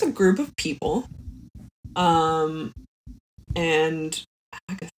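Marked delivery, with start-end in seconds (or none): none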